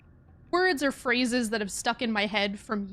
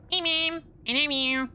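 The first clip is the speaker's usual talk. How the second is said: higher-pitched cat voice; put-on talking cat, pitched up; Memers voice; 4 semitones higher than usual. Voice she gave her own cat, high cat voice. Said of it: "the Memers voice, which is".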